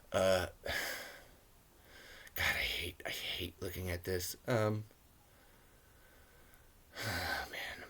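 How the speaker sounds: whispered, defeated groan; pained whisper at the screen; weary whisper. Real, not acted. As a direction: mutter it under your breath in disgust. Groaning while watching the film.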